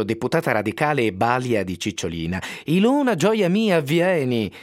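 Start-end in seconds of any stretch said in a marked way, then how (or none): 2.6-4.5 much higher, eager calling of a motherly minder; high, affectionate calling out to Ilona; fond calling out. Acted. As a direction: call her over with gushing affection. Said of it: "Arrivò l'Adele Faccio".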